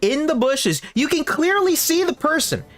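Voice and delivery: high-pitched, insistent incredulity; exasperated disbelief. Real, not acted. Storyteller insisting the bush person was clearly visible, exasperated. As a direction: insist on the obvious, pitched right up.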